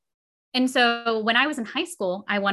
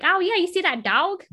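high, lilting imitation of a daughter's Southern twang; mock Southern kid, pitched high; Southern accent slipping out; 9 semitones higher than usual. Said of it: "every now and then she'll be like".

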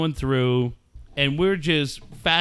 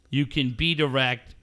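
normal talking voice

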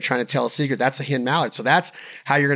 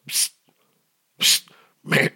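whispered psst; slower than usual. Shown as a demonstration. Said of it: "a little cut of air".

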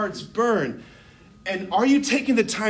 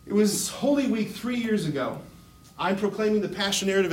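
ordinary talk

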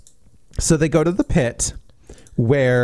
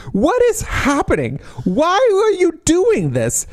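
exasperated disbelief; 13 semitones higher than usual. Retold or imitated. Reacting to pepper spray scene.

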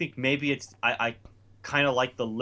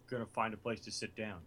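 meek mumble; 4 semitones lower, 13 dB quieter than usual. Retold or imitated. Retelling his own meek reply.

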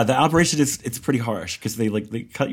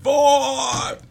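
hype man; 14 semitones higher than usual. Pretend. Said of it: "shout the numbers behind you".

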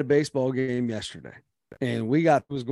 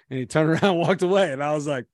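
usual speaking voice

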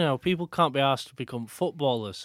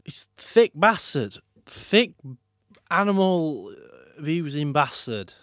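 mock rant; 4 semitones higher, 5 dB louder than usual. Real, not acted. Ranting at animal abusers.

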